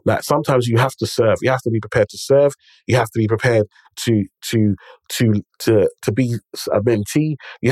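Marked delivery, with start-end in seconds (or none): none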